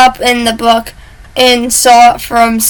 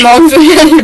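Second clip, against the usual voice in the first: mom spaghetti shouted higher and louder; 5 semitones higher, 6 dB louder than usual. Pretend. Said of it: "repeated chant varied".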